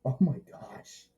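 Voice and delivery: awed whisper; stunned whisper of disbelief; whispered oh my gosh. Retold or imitated. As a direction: breathe it out in amazement. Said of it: "and I'm like".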